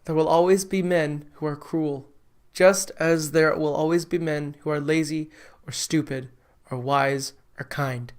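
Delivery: sadly. Acted. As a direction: speak gently in grief. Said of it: "Hideaway said sadly".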